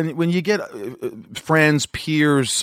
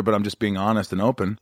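usual speaking voice

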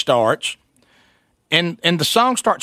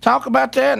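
mock wife voice; 8 semitones higher than usual. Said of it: "He said".

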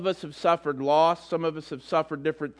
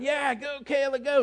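cheering; 10 semitones higher than usual. Retold or imitated. Dad cheering daughter's race.

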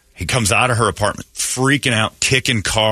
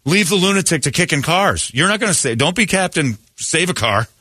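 higher, urgent pleading at the screen; urgent warning; 6 semitones higher than usual. Retold or imitated. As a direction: warn him off, pitched up and insistent. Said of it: "I'm like".

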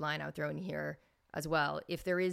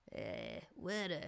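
put-on Philly accent; 4 dB quieter, much slower than usual. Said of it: "the Philadelphia accent".

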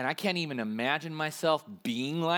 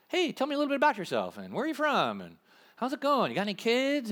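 bright, quick, casual chit-chat, a touch higher; friendly small talk; 7 semitones higher, faster than usual. Shown as a demonstration. Pastor showing how to chat with a stranger, casual and friendly. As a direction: sound like an easygoing friend asking about their life. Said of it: "like you would talk to a normal person".

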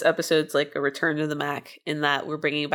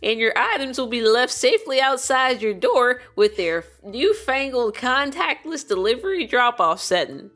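put-on old-timey cowboy voice; 5 semitones higher than usual. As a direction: play a folksy cowboy miner selling delivery. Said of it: "my old-timey, uh, cowboy miner voice".

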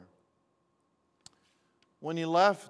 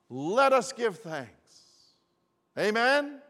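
preaching; 3 semitones higher, 6 dB louder than usual. Acted. Pastor urges the congregation to give thanks, preaching. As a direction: rise up and lift the congregation.

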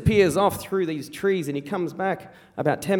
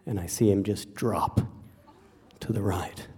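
very low, soft, halting narration; hushed, grave storytelling; 9 semitones lower, 7 dB quieter than usual. Acted. Telling how the shot hit Dad, in a low hush. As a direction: let each moment land quietly, with pauses.

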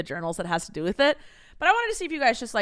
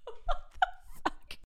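high, breathy laugh through the words; laughing disbelief; 7 dB quieter than usual. Real, not acted. Laughing at diary entry.

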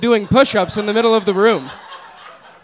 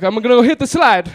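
higher, excited Italian man imitation; 6 semitones higher, 4 dB louder than usual. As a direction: announce it eagerly as the Italian. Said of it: "the Italian guy took off his shirt".

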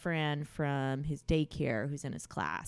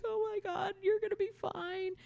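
tearful reassurance. Retold or imitated. Mother comforting crying son.

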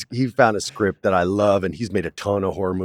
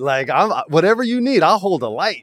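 much higher, eager surfer-bro enthusiasm; 9 semitones higher, 3 dB louder than usual. Retold or imitated. To the director, offering to help, eager and high. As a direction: gush with mock-excited keenness.

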